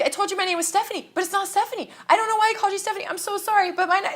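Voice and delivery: high, fast, breathless babbling apology; nervous fan tripping over her words; flustered starstruck rambling. Retold or imitated. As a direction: gush it out in a starstruck panic.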